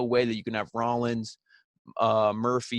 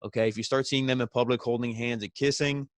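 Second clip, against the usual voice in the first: usual speaking voice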